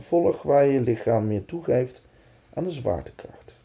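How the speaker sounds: calm, unhurried meditation voice; guided meditation. Acted. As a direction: gently lead them into relaxation. Guides a body relaxation exercise.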